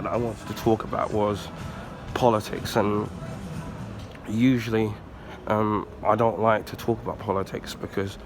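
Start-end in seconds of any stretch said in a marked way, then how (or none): none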